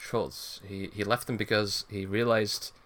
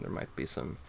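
low hushed hint of trouble; ominous whisper; 8 semitones lower, 9 dB quieter than usual. Real, not acted. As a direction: drop to a dark whisper. Ominous understated aside.

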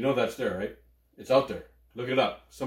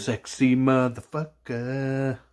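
slow sultry; much slower than usual. Real, not acted. Admiring own shine.

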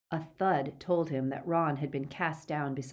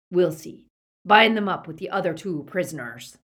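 loud, commanding werewolf villain; bully the captives with loud menace; menacing Greyback; 6 dB louder than usual. A narrator's reading. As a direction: gang leader lecturing, then ordering. Greyback voiced beside narration.

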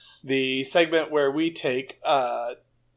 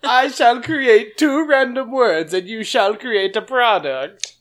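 pompous booming ogre mage; 5 semitones higher, 5 dB louder than usual. Acted. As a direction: declaim like a pompous wizard. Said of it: "It's Mad Eye".

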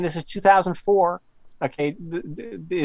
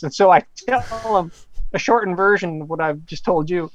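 ordinary delivery

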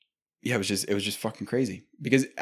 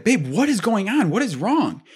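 higher, louder, retold worry; a partner asking what's wrong in the dark, higher and louder with retold alarm; alarmed calling out; 10 semitones higher, 5 dB louder than usual. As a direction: ask her urgently what's wrong. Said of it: "And I was like".

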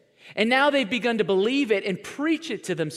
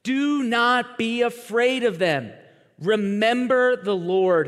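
slow, firm, emphatic charge; rallying command; 4 semitones higher, 4 dB louder, slower than usual. Acted. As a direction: hammer each word like a leader to his people. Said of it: "To the rest of the people".

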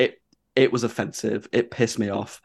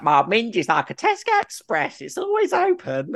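mocking the host, pitch way up on always; 11 semitones higher than usual. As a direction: ham it up like the brash MC. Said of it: "she's like".

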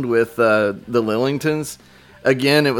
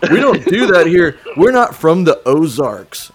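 mock snooty local; 4 semitones higher, 5 dB louder than usual. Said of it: "And like".

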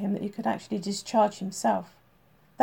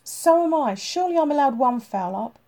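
higher and louder, a defiant snap back; heated retort; 5 semitones higher, 7 dB louder than usual. A narrator's reading. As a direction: throw it right back at him. Tim argues back with his boss.

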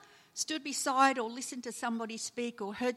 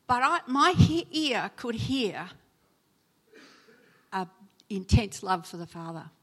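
ordinary delivery

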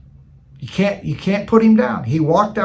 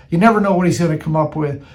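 ordinary delivery

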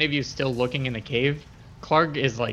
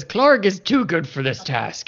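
slow, lightly voiced haughty brute; Clark; 4 semitones higher, 5 dB louder, slower than usual. Clark refuses the goblin's request, slow and haughty. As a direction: say it slowly, full of himself. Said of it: "He says".

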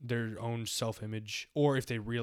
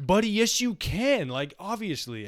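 louder and higher, incredulous; exasperated retort; 9 semitones higher, 7 dB louder, slower than usual. Retold or imitated. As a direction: tell him he obviously can. Retorting to a naive friend.

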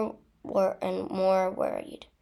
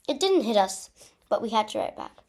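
ordinary delivery, nothing marked

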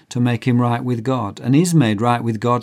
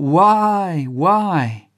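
high anguished cry of why; 7 semitones higher, 3 dB louder than usual. Acted. Condemned man cries out to the judge, high and anguished. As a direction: cry out in disbelief, lightly acted. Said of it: "cries out".

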